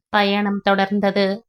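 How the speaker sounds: usual speaking voice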